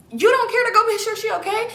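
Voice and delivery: high, disbelieving question; incredulous scolding. Retold or imitated. Scolding Keisha for leaving her grandma behind, incredulous.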